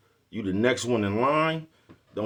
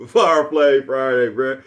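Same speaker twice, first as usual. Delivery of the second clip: slow loud hype call, pitched up; hype catchphrase; 3 semitones higher, 7 dB louder, much slower than usual. Real, not acted. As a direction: belt "Fire Flame Friday" like a stage drop. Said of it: "hyping catchphrase again".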